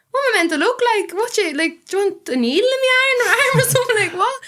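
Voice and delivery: high, quick, incredulous comeback; indignant retort. Retold or imitated. Girl answering back to a snob on the bus, indignant. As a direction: retell it as an affronted challenge, half laughing. Acted retort to a snobbish stranger.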